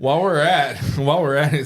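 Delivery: loud, high, giggly stumble; laughing. Real, not acted. Tired host fumbling a plug to the co-host, laughing. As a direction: try to get the words out through laughter.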